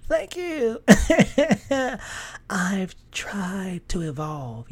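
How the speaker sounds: high mock giggle, trailing into slow softness; hammed-up tittering thanks; coy false modesty. Stylist's former assistant accepting a compliment, coy false modesty.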